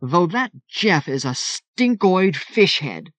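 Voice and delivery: slow, high angry burst; a flash of old rage; angry outburst. A narrator's reading. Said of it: "A brief echo of that rage came back".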